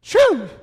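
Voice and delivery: loud, punched "shoo"; southern dialect. Shown as a demonstration. Preacher telling the backslider he stinks, loud southern shoo. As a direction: exclaim "shoo" at a stench, hillbilly style. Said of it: "words of that southern dialect".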